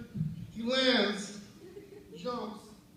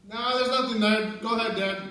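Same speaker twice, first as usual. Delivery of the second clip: loud, higher call to Dad; 3 semitones higher, 5 dB louder than usual. Retold or imitated. Buddy calling to Dad behind the bus, calling out. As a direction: imitate a kid shouting the all-clear.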